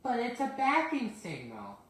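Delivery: Percy protesting to his driver, indignant. Acted. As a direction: insist you're right, put out. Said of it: "Percy protested".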